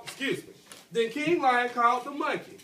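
normal talking voice

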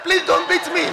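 high, loud comic plea; mock afraid. Preacher retelling his plea to the man threatening to beat him, mock frightened. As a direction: beg in exaggerated alarm. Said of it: "I was immediately afraid".

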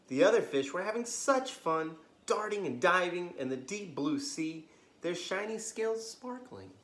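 lively bedtime storybook reading; story narration. A narrator's reading. Storyteller reading to kids at bedtime, lively. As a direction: read it like a children's librarian at story time.

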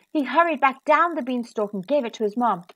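ordinary delivery, nothing marked

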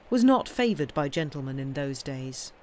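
usual speaking voice

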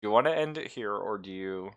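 ordinary talk

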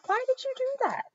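very high-pitched exasperated question; yelled protest. A narrator's reading. Mister Rogers after she frees the fish, yelled protest. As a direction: protest in a voice pushed way up. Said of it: "yelled Mister Rogers".

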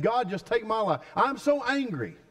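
preacher acting Jonah's angry death wish; frustrated Jonah. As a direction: throw up your hands at God. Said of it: "Jonah was so frustrated, he said".

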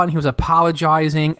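ordinary talk

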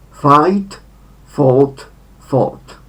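fought said with a lengthened O; long O in fought. Shown as a demonstration. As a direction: hold the O in fought. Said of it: "wydłużone o". (fought z wydłużonym o)

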